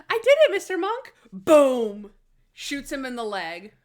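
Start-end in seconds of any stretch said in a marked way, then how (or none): 0.1-1.1 high, loud, quick burst; mock excited cry. Acts out Natalie's excited announcement. 1.3-2.1 loud, explosive boom; imitated gunshot.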